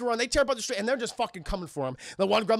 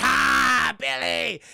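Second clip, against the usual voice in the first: loud, higher creature shout; gremlin ambush yell; 4 semitones higher, 6 dB louder, much slower than usual. Retold or imitated. Streamer as Stripe surprising Billy, mock villain yell. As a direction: yell it like a monster pouncing.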